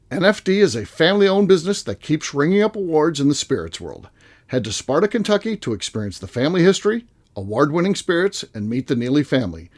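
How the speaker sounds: ordinary talk, nothing marked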